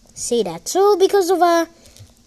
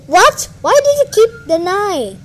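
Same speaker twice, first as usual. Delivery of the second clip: high, loud indignant questioning; mock outrage, raised and loud; imitating annoyed opponent; 6 semitones higher, 7 dB louder than usual. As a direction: mimic an exasperated player. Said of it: "you say".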